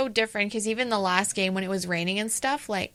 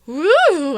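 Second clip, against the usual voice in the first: loud, high "woo" of excitement; excited whoop; 7 semitones higher, 10 dB louder than usual. Retold or imitated. Fan retelling the electric game moment, excited whoop.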